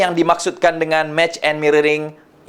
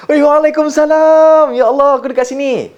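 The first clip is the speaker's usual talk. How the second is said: high, loud, delighted reply; mirrored excited tone; 11 semitones higher, 5 dB louder than usual. Shown as a demonstration. Answering a best friend's call, matching the excited tone. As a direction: throw back a friend's high-pitched enthusiasm. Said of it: "Kita akan match and mirrorkan tone of voice".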